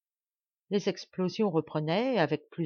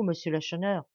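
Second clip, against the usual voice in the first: plain reading voice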